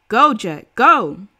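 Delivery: loud, clear command to a dog; commanding shout. A narrator's reading. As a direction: send the dog in. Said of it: "a voice, very clear".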